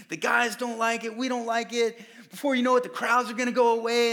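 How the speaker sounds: a hint of anxious big brother; worked-up friend; urgent pleading Peter. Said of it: "he's like".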